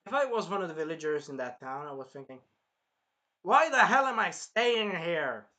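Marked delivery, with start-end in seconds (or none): 3.4-5.5 loud, drawn-out, higher question; exasperated villager. Acted. Villager wondering about leaving town, exasperated. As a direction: stretch it out in loud disbelief.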